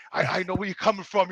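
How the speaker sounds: low, very soft helper's voice; hushed, gentle retelling; retold kindly reassurance. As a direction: imitate a nice man reassuring someone. Voicing the kind helper.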